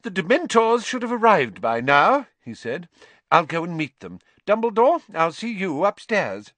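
a busy official excusing himself; bustling minister. Acted. Minister heading off to meet the Dementors, high and brisk.

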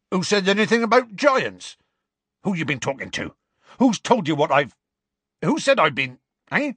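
high, loud, blustering demands; flustered alarm. A narrator's reading. Hagrid caught out about the giants, flustered. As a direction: splutter questions and break off unfinished.

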